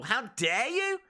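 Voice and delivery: very high, hammed-up New York indignation; squealed mock-angry New York accent; mock-offended New York accent. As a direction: play an outraged New Yorker. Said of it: "Sorry, but terrible".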